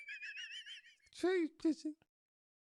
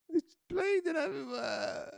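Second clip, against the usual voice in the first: usual speaking voice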